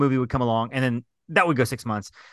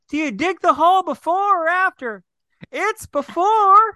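high, strained character voice, slowing at the end; high mock yell; 3 dB louder, slower than usual. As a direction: squeak it out like a cartoon old man. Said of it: "yells out".

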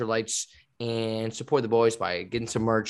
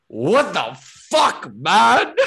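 loud, high, drawn-out mock yell; slow, stretched-out yell, played for laughs; imitated coach yell; 10 semitones higher, 9 dB louder, much slower than usual. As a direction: imitate an exasperated coach yelling across the gym. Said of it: "like, yelling at me".

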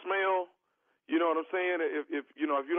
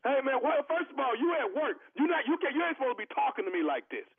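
indignant prank caller; 7 semitones higher, faster than usual. Pretend. Prank caller to clerk, higher scolding. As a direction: scold him for his tone.

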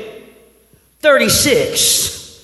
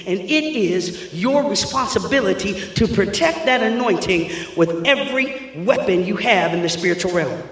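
ordinary talk

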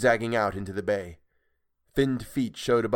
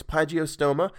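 ordinary delivery

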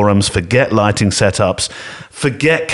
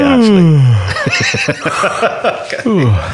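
laughing; 10 semitones higher than usual. Real, not acted. Laughing at jingle button.

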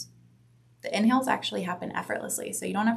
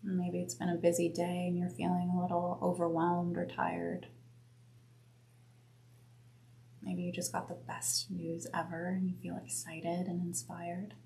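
guided meditation; 3 dB quieter than usual. Acted. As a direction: guide them gently inward. Leads a breath meditation.

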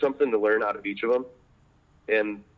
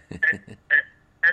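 small choppy sanding noises, higher; imitated timid sanding; 5 semitones higher, 4 dB louder than usual. Mentor mocking timid little cuts with choppy noises. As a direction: mimic little hesitant grinding sounds. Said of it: "I don't wanna hear these little".

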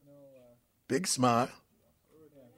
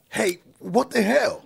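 startled outburst; 12 semitones higher, 4 dB louder, slower than usual. Real, not acted. Startled by cucumber removed.

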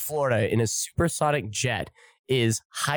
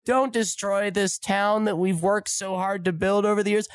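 mock crying little girl; 8 semitones higher than usual. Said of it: "big, booming, crying voice".

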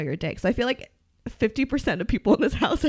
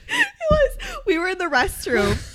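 high laughing, near tears; cracking up while telling it; 11 semitones higher, 4 dB louder, slower than usual. Real, not acted.

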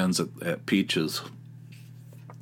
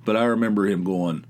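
ordinary talk, nothing marked

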